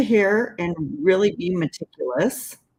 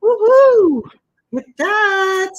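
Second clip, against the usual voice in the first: loud, high delighted whoop; high excited cheer, slowing on the last words; 13 semitones higher, 9 dB louder, slower than usual. Real, not acted. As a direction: squeal with real excitement over the result. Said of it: "I'm so excited".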